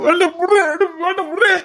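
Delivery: high yelping protest from cold water; yelp, gasping at icy water; shocked yelp. Acted. Husband doused with cold water. (குளிர் நீரில் அலறல்)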